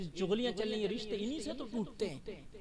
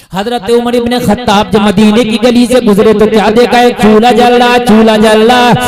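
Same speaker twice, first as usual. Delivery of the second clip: full-force preaching; 4 semitones higher, 29 dB louder than usual. Acted. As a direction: chant the tale to the crowd, stretching the key lines. Chanted story of Umar, sermon. (Josheela was, लंबी खिंची ऊँची आवाज़)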